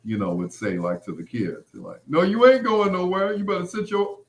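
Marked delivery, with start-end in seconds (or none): 2.1-4.2 higher, loud stern dad; strict dad voice. Retold or imitated. Father scolding the kids, higher and loud. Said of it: "something that he would say".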